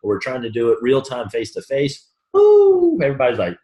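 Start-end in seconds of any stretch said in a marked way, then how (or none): 2.3-3.0 woo. Retold or imitated. Reaction to face-to-face pressure.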